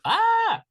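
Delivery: short, high, loud "ah" cry; sudden yelp. Real, not acted. Player lets out a sudden cry.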